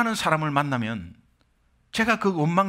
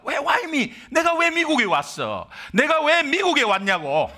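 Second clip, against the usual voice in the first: higher, bitter complaint; resentful why me; 9 semitones higher, slower than usual. Retold or imitated. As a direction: grumble at God, higher and aggrieved. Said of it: "그 당시는 원망도 했습니다". (미국 사역 시절 하나님께 원망하는 목소리)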